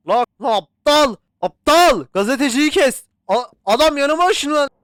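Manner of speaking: high, loud angry shouting; angry yelling. Real, not acted. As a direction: shout furiously. Panicked shouting at mannequin. (öfkeli yüksek bağırış)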